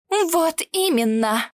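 slow, pointed indignation; indignant. A narrator's reading. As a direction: spit it back slowly. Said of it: "сузила глаза Агнетта".

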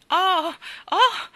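high, loud cry of giddy alarm; frightened exclamation. A narrator's reading. Ellen cries out in fright high above the houses. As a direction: squeal as if looking down from a great height. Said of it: "esclamava Ellen".

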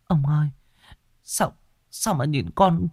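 high, soft frightened whisper to husband; trembling fear. A narrator's reading. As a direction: shrink back in fear. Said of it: "Bà Kiểm run run bấu tay vào vai chồng". (vợ run sợ nói với chồng)

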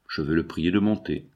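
ordinary delivery, nothing marked

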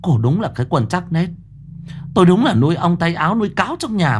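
put-on high, furious old matriarch seething at her daughter-in-law; through gritted teeth. Mother-in-law accuses her daughter-in-law of theft, furious and shrill. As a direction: spit out every accusation in rage, rising to a scream. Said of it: "Bà Lai nghiến răng nghiến lợi".

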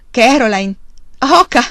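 playful, excited shout to his wife; exuberant cry. A narrator's reading. Exuberant man calls to his wife.

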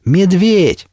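high, drawn-out call; repeated shout. A narrator's reading. Narrator calls to Медведь behind the embankment, shouting. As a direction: call the name out to someone far off. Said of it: "я повторил".

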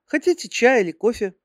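put-on high girly secretary voice; sweet, high-pitched young secretary; cartoon princess. A narrator's reading.

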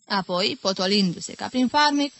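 usual speaking voice

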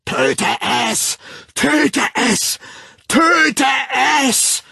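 high, very loud roar, mounting; relentless bellowing. A narrator's reading. The Savage lashing out amid the mob, relentless bellowing. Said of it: "brüllte der Wilde unentwegt".

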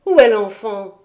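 loud call from the villagers; shouted question. A narrator's reading. Villagers shout at Dete about the child, loud. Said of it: "lui criait-on".

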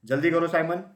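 loud, high call to a dawdler. A narrator's reading. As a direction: call him from down the pond. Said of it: "चिल्लाई Mrs mouse".

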